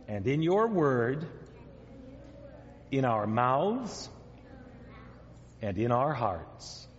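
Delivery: measured prayer in short phrases; repeat-after-me prayer. Real, not acted. Leading children in prayer to repeat, measured phrase-by-phrase delivery. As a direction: lead a prayer phrase by phrase, leaving room to echo.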